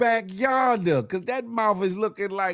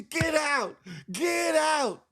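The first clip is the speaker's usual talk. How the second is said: teacher yelling; 9 semitones higher, slower than usual. Retold or imitated. Teacher orders the boy out, high shouted get out. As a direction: throw him out of the room, yelling. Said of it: "And she".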